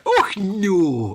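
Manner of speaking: a hint of posh Edinburgh lady; proper Edinburgh accent. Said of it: "puts on the proper Edinburgh accent".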